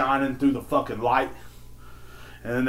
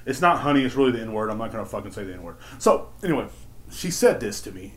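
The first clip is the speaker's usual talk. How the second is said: ordinary talk, nothing marked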